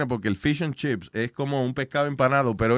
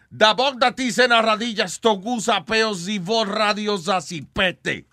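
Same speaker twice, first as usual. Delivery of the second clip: mock Serbian; 7 semitones higher than usual. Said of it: "En Serbia, si te dicen".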